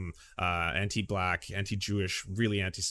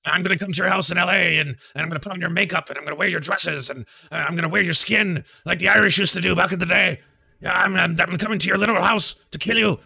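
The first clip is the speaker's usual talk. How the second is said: high, loud put-on voice ranting the threats; mock Sam Hyde Irish rant; 9 semitones higher, 9 dB louder than usual. Mocking Sam Hyde threatening to visit Hassan, high hammed-up rant. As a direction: shout it like a deranged Irish character. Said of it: "And then it's like".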